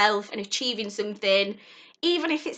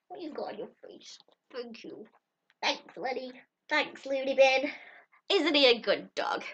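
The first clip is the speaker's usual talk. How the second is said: pet talk; 3 semitones higher, 6 dB quieter than usual. Real, not acted. Fussing over her dog Lenny, soft pet talk. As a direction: fuss over the dog gently.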